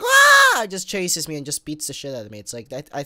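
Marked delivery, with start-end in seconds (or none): 0.0-0.7 very high, loud mock shriek; imitated monster shriek. Purple animatronic lunging, a high mock attack shriek. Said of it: "just little purple one's like".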